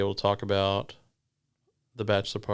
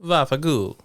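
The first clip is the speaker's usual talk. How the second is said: put-on Italian accent; 5 semitones higher, 5 dB louder than usual. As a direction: spit it like a tough Italian.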